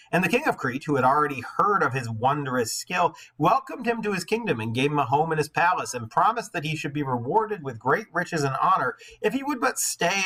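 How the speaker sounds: lightly lifted read-aloud voice; steady storybook reading; story narration. A narrator's reading. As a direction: read the myth to the class. Reads the myth aloud.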